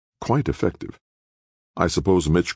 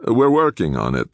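dismissive; 9 semitones higher, 5 dB louder than usual. A narrator's reading. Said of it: "in a flat, almost dismissive tone".

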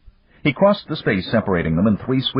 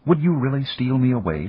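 higher-pitched eager question; breathless; 7 semitones higher than usual. A narrator's reading. Woman asks her lover about running away, breathless. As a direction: ask with a touch of breathless wonder. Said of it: "she asked breathlessly".

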